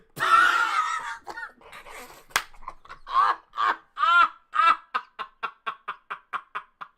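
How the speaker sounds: loud, high helpless laughter; howling laugh. Real, not acted. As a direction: burst out laughing, can't stop. Streamer cracks up at the clip.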